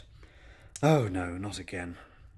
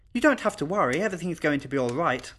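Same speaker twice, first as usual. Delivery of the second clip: lightly voiced child, higher and assured; calm little girl; 6 semitones higher, 4 dB louder than usual. Little girl reassures frightened dinner guests, calm. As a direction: reassure them as an untroubled child. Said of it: "said Twyla calmly".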